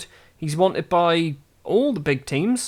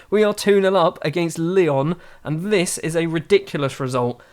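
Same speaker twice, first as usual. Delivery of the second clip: ordinary delivery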